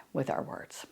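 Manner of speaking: soft unvoiced whisper; dramatic whisper. Real, not acted. Host telling viewers how words hurt, whispered. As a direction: drop to a hush for effect.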